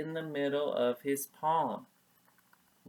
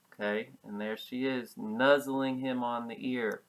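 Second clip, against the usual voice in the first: plain reading voice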